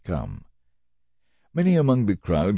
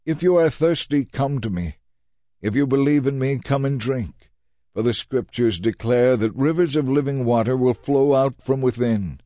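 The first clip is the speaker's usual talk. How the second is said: higher, proclaiming call out to the crowd; shouting to crowds; 6 semitones higher than usual. Acted. Jesus shouts to the festival crowds, a raised proclaiming call. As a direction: lift your voice over the festival crowd. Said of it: "Jesus stood and shouted to the crowds".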